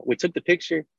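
ordinary delivery

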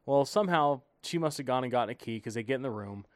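ordinary delivery

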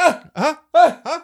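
high, short mock gasps; acted-out helpless grunts; panicked flailing noises. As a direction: yelp helplessly as if struggling. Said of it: "when you're like".

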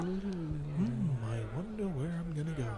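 soft, hushed thinking-aloud murmur; barely there, like a thought in your head; wispy inner voice. Retold or imitated. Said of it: "just wispy in your head".

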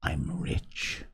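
low, hushed secret; whisper. A narrator's reading. Castaway confiding his secret to the boy, whispered. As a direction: drop right down and whisper it. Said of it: "lowering his voice to a whisper".

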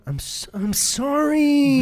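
mock meek apology. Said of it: "the rest of the night is me going".

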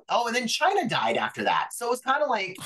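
normal talking voice

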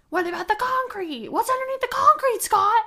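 loud, high mock screaming; hammed-up TV host shrieking an accusation; Nancy Grace. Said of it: "she screamed about it every day".

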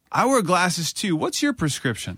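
awkward fan. Retold or imitated. Thirteen-year-old fan to Joey backstage, high and awkward. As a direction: blurt it out like a starstruck kid.

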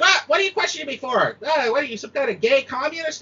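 high hammed-up pundit voice; high, hammed-up right-winger mocking the questioner; mock right-wing blowhard. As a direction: sneer like a cartoon bigot. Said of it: "It's like".

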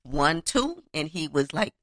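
ordinary talk

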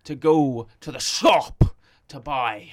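slow, loud, overenunciated Kingpin; mock-menacing crime boss voice; Kingpin impression. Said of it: "Here you go".

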